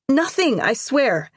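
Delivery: high, loud denial; urgent protest. Acted. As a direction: plead innocence urgently. Girl protesting innocence.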